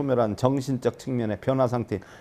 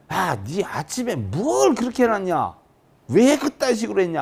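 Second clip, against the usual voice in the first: higher, slowing into an angry scold; scolding husband; 6 semitones higher than usual. Acted. Husband scolding his wife over the phone, mock angry. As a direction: play the husband telling her off, rising.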